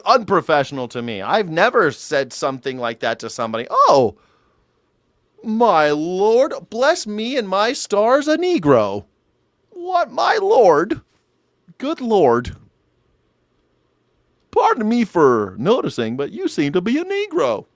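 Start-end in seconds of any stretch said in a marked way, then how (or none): 3.7-12.5 high, drawn-out mock astonishment; mock scandalized shock. 14.5-17.6 high, hammed-up apologetic astonishment; mock scandalized politeness.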